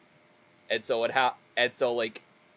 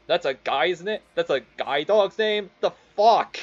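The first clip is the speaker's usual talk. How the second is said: incredulous questions climbing high and louder; imitated disbelief; 6 semitones higher, 4 dB louder than usual. Native guy hearing the dog's name, mock disbelief. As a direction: build from puzzled to loud mock outrage.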